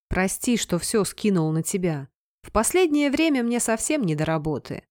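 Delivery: plain reading voice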